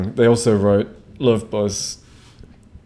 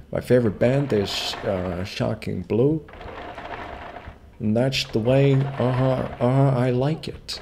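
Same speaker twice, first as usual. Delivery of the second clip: lightly put-on Dutch accent; a hint of Dutch fan voice; mock Dutch accent; 3 semitones higher, 3 dB quieter than usual. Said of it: "Dutch accent continued".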